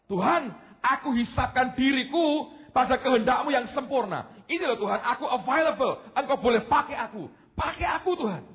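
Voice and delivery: a surrendering prayer; prayerful plea. Acted. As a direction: plead with God, high and earnest.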